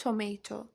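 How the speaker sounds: tomato with a full T, toh-may-toh; teacher's careful toh-MAY-toh. Shown as a demonstration. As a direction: sound both Ts crisply in tomato.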